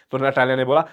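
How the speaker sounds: plain reading voice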